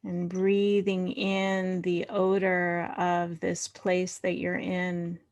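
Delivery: unhurried meditation voice with long pauses; guided meditation. Real, not acted. Leader inviting the group to find body and earth, guided meditation. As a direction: settle them gently into body and breath.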